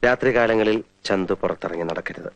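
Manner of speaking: low, grave warning with long pauses; dead serious. Acted. Doctor secretly warning Chanthu of a coming murder, low and grave. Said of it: "തമാശ അല്ലാത്തൊരു കാര്യം പറയാൻ പോവാ".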